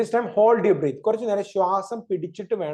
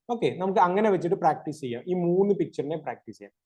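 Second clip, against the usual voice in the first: ordinary talk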